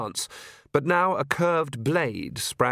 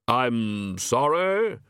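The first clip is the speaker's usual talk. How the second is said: hollow Death voice; much slower than usual. Acted.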